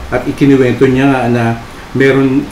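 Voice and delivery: usual speaking voice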